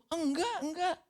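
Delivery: high-pitched, insistent denial; panicked denial. Acted. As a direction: brush off the accuser in a hurry. Peter denies again.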